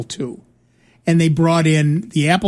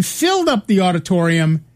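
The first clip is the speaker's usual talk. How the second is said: normal talking voice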